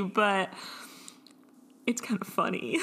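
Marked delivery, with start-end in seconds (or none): 1.9-2.8 guilty little whisper. Real, not acted. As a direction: admit it under the breath.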